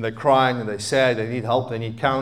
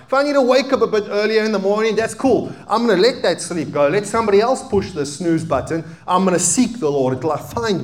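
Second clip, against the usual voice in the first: preaching at full force; 6 semitones higher, 4 dB louder than usual. Acted.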